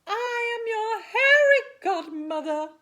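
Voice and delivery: high, loud magical voice; grand godmother. Acted. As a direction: proclaim it like a fairy godmother. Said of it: "said the enormous spider".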